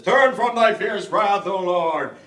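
over-the-top fifties film Moses; Charlton Heston impression. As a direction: declaim it like an old Bible epic. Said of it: "Charlton Heston says".

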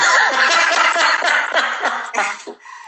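bursts out laughing at her own story; a real, breathy laugh; laughing hard at the memory. Real, not acted.

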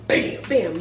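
ordinary talk, nothing marked

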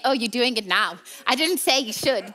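ordinary talk, nothing marked

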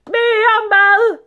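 very high, loud, drawn-out scream; screamed demand. A narrator's reading. Greedy sultan demanding food from his servants, screaming. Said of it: "skreg sultanen".

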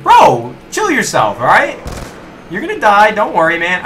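high, loud mock telling-off; exasperated yelling. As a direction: yell at a noisy pest to calm down. Yelling back at a noisy zombie.

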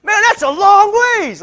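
high, loud, drawn-out disbelief; imitating a man amazed by the distance; astonished exclamation. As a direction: exclaim it way up high, stretching the words. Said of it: "like that".

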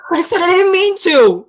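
high, loud protest; defensive outburst. Acted. Younger brother defends himself to older brother, upset shout. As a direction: throw it back at him, upset.

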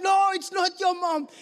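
firm contradiction. Retold or imitated. Security guard refusing to believe the lost boy, firm contradiction.

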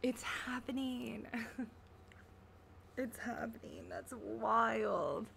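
giddy breathless whisper, laughing under it; excited whisper. Real, not acted. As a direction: whisper it like a thrilling secret.

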